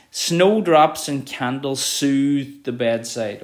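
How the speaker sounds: slow, soothing delivery; slow, calm poetry reading. Shown as a demonstration. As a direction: stretch out soothe. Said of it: "it creates a feeling of calm".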